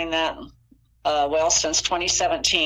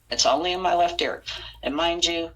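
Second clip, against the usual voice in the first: usual speaking voice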